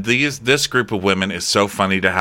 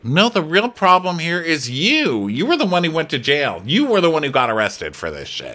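indignant mock retort; 6 semitones higher than usual. Son throwing blame back at mom, indignant. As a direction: hit YOU high and stretch it, hammed-up outrage. Same retort repeated more heatedly.

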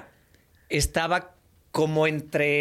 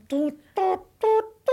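high vocal knock-knock sounds; knocking sound imitation. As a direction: mimic knocking with the voice.

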